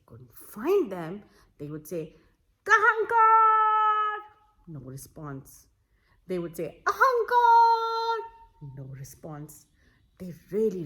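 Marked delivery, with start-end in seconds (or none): 2.6-4.2 long, high, loud call across the forest. A narrator's reading. Family searching calls out for him loudly. Families calling for missing man. 6.9-8.2 long, high, loud call across the forest. A narrator's reading. Family searching calls out for him loudly. Families calling for missing man.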